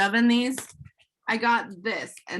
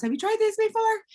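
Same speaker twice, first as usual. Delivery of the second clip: chirpy pitch; 11 semitones higher than usual. Acted. Acts out pitching strangers in line.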